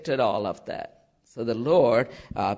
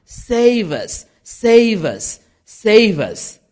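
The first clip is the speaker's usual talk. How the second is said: high, louder chanted cries, each falling; crowd acclamation; 7 semitones higher, 5 dB louder than usual. Retold or imitated. Crowd begging to be saved, acclaiming cries. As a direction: cry it out in repeated bursts.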